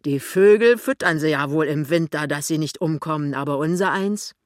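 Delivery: loud, grumbling fat tradesman; loud rhetorical grumble; blustering shopkeeper husband. Acted. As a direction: complain aloud like a put-upon fellow.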